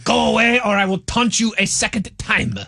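mock French knight taunting in a French accent. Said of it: "your outrageous French accent".

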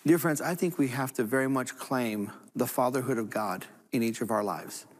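usual speaking voice

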